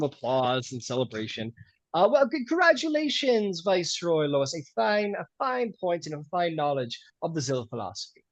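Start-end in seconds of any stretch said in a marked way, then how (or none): 1.9-8.2 formal host. Acted. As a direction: proclaim it to the whole hall. Host congratulates Viceroy Lois.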